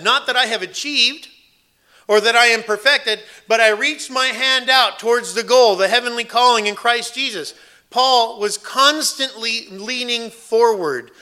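ringing sermon proclamation; forceful preaching. Acted. Preaches Paul's words at full force.

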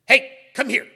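put-on loud, sudden call to the kids; sharp shout. Shown as a demonstration. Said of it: "It's, it's loud. It's sudden".

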